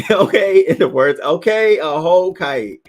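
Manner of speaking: high, loud catchphrase imitation. Quoting a catchphrase to the live chat, high and loud. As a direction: belt it out high, stretching the last words. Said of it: "Shout out to [name]".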